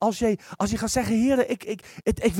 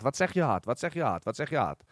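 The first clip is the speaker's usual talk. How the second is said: fading question; 6 semitones lower, 7 dB quieter than usual. Acted. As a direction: repeat the question, each time lower and softer until hushed. Asking the team, repeated and fading.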